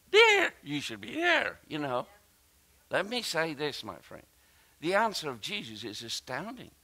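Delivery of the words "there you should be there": mock religious arguer. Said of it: "They're still talking like that".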